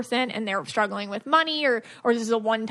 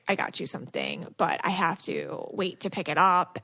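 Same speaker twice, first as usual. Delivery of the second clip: imitating husband; 6 semitones lower, 5 dB quieter than usual. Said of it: "and you were like".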